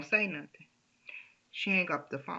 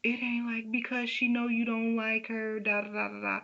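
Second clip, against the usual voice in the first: pleading husband; 6 semitones higher than usual. Retold or imitated. Husband denies, voiced by wife.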